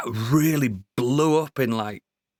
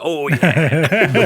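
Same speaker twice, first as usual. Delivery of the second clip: laughing; 3 semitones higher, 7 dB louder than usual. Retold or imitated. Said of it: "I'm like".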